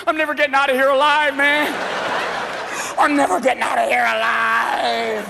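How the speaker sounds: mock terrified wail; paranoid panic. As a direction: cry out trapped in sand. Paranoid golfer in sand trap.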